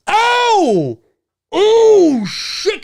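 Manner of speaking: high, swelling oh of shock; acted high-pitched astonishment; shocked reaction. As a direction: react like a startled audience.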